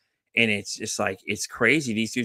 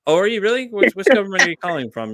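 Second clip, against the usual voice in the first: mock sarcastic; 7 semitones higher, 7 dB louder than usual. Mocking scam caller.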